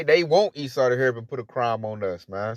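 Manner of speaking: ordinary talk, nothing marked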